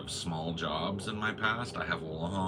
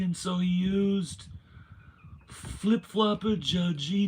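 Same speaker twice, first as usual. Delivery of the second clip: much higher, louder, slow ranting voice; Trump impression; 7 dB louder, slower than usual. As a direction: rant it like Trump at a rally. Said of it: "he'll say something like".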